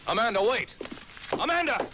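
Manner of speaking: high, urgent call after someone. Acted. Doctor calling after Amanda to wait, urgent. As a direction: call out to stop her, voice pitched right up.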